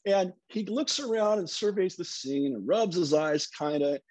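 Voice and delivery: ordinary talk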